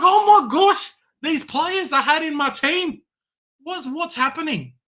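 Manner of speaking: imitating a teary fantasy coach; mock crying. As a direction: whine like a fan in despair. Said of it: "I was crying".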